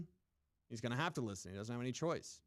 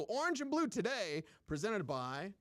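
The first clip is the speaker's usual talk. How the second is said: hyped show plug; 6 semitones higher than usual. Acted. Show name plugged, announcer style.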